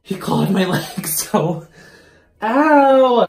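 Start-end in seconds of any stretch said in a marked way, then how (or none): 2.4-3.3 loud sharp cry of pain; pained yelp. Real, not acted. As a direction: yelp as the cat claws. Clawed by cat.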